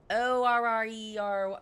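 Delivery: letters chanted one by one; spelling out. Real, not acted. Streamer announcing the spelling of orrery, letter by letter.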